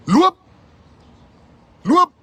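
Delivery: high, loud cry of go; shouted command. Real, not acted. Telling the DA to leave, loud shouted command. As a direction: yell it out like a dismissal.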